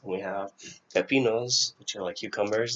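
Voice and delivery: normal talking voice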